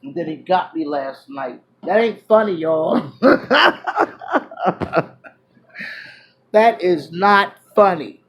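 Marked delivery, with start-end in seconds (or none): 3.2-5.3 high burst of laughter; real laugh. Real, not acted. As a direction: laugh out at the memory. Laughing at own story.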